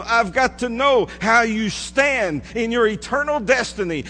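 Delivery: rising sermon plea; impassioned preaching. Acted. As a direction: preach it as Jesus pressing his men. Preacher voicing Jesus' plea.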